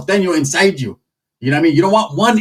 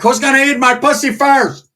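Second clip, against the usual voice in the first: high, far-off put-on voice for the tia; tia's crude prison voice; 9 semitones higher than usual. Comic voicing his tia calling out in prison, high put-on voice. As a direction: squeal it like a rowdy inmate aunt.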